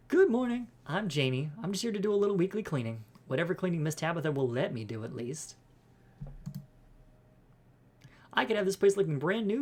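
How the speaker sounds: bubbly housekeeper voice; cheery eager cleaner. Acted. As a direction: introduce yourself brightly and eagerly, pitch lifted.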